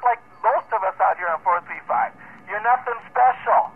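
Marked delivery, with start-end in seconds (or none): 2.5-3.7 slow, emphatic "You're nothing special". Real, not acted. Radio operator telling Ted off on air, emphatic. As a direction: draw out "You're nothing special", higher than usual. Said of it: "And for emphasis".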